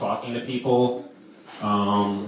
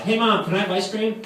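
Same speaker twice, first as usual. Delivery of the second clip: put-on little kid asking; 8 semitones higher than usual. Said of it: "I'd be like".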